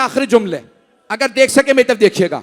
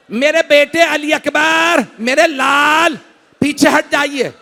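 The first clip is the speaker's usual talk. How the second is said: preaching at full force; 2 semitones higher than usual. Acted. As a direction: lift the mourners with a wailing tune. Majlis building to full force.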